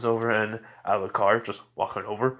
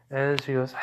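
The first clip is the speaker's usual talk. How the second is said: plain reading voice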